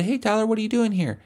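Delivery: put-on high, chummy voice of a pushy kid; high sly buddy voice; pushy cool kid.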